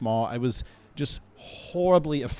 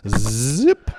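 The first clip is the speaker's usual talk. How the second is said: zipper noise; 4 semitones lower, 9 dB louder than usual. Retold or imitated. Zipper sound after going to pee.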